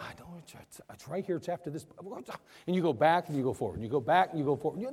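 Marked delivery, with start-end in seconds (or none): 0.0-1.8 much softer, half-mumbled search; muttering to yourself. Retold or imitated. Someone hunting for Amos in their Bible, muttering. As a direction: mutter while flipping pages. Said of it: "you're like".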